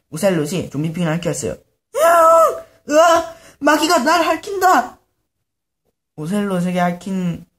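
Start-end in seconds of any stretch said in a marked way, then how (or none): 1.9-5.0 very high, loud shrieking; terrified scream. Acted. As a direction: scream as if something licked you. Pigman licked, screams.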